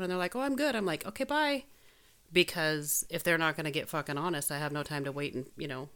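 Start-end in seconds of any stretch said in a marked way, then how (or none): 0.4-1.6 high, mocking fake cheer; mock chirpy. Mimicking people who say they're fine, mock cheerful. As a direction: lightly mimic a breezy brush-off.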